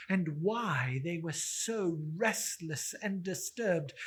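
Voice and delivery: plain reading voice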